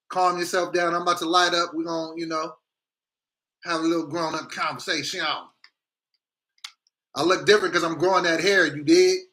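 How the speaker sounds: normal talking voice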